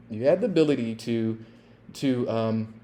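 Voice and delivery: ordinary talk, nothing marked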